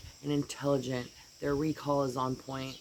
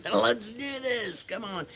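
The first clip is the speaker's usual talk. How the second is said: higher, slow pep talk voiced for the dog; lightly voiced eager dog; 6 semitones higher, slower than usual. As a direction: pump yourself up like the eager dog. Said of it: "it's like".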